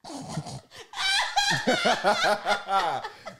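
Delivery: high, building belly laugh; helpless laughter. Real, not acted. Man cracking up while trying to demonstrate, laughing hard. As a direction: burst into loud, long laughter.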